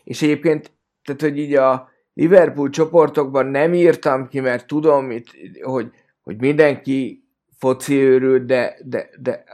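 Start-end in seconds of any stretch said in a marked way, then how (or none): none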